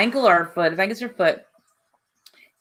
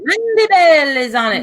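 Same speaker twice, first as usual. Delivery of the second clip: mock accented call; 8 semitones higher, 4 dB louder than usual. Cutting in on stream, high mock call. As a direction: sing out the name as a put-on character. Repeats her German lady catchphrase.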